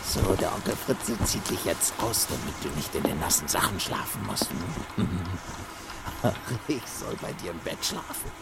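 very soft, intimate, sinister murmur; creepy whisper. Acted. Fritze murmurs creepily over the unconscious boy. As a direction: murmur to the sleeping boy, menacingly tender.

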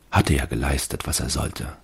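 ordinary delivery

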